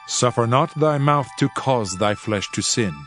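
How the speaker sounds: ordinary delivery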